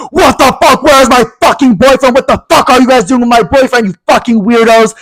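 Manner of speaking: high-pitched irate boyfriend impression; hammed-up high, indignant tirade; mock angry yelling. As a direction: imitate a furious boyfriend ranting down the phone. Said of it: "Stop fucking yelling at me".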